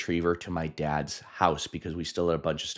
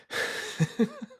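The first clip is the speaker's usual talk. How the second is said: airy nervous laugh. Real, not acted.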